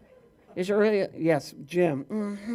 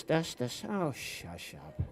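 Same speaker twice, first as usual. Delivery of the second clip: usual speaking voice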